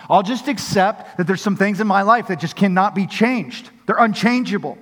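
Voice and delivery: impassioned preacher's push; full-force preaching. Acted. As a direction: build the fervour line by line.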